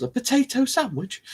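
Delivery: high-pitched disbelieving question; incredulous. Acted. Host amazed at inventing meals, incredulous.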